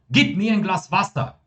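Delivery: high-pitched, pressing command; urgent order. Shown as a demonstration. Teacher showing how asking for water becomes urgent, commanding intonation. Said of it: "olha só a entonação".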